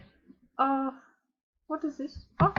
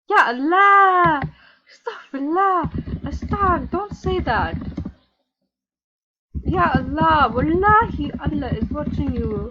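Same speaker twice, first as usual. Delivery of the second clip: loud scolding; 2 semitones higher, 8 dB louder than usual. Acted. Loud scolding of dancing girl.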